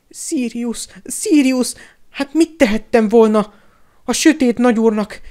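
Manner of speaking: high, loud whimpering coward; desperate, tearful self-justifying whine; tearful pleading. A narrator's reading. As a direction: wail your excuses in a high voice. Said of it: "Pettigrew sírva fakadt".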